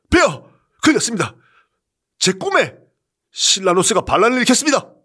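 높고 빠르게 몰아치듯; 숨 가쁘게 아뢰기; 다급한 보고. Acted. 비서실장이 다급히 고함. (황제에게 다급히 아뢰는 비서실장, 높고 빠르게)

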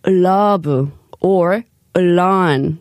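slow "ul-love" and "ul-lawn", each led in by ul; ul before L. Shown as a demonstration. Host demonstrating the book's rule for L words, ul before L. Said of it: "add an ul sound when pronouncing".

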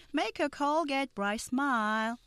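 slow, clear model sentence; slow read. Shown as a demonstration. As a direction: say it slowly for learners. Dialogue line read slowly.

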